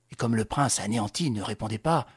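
ordinary delivery, nothing marked

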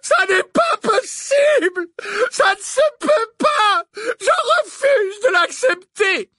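very high, slow, loud wailing refusal; hysterical crying shout. A narrator's reading. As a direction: wail in desperate denial, sobbing. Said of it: "hurla-t-elle".

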